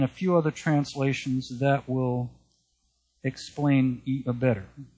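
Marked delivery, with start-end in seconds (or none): none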